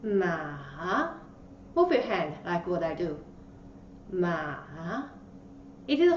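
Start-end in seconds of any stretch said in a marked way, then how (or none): 0.0-1.1 "Ma" dipping low, then rising; long "Ma" that sinks before it lifts; third tone. Shown as a demonstration. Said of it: "at the beginning and then goes up". 4.1-4.6 "Ma" dipping low, then rising; long "Ma" that sinks before it lifts; third tone. Shown as a demonstration. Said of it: "at the beginning and then goes up".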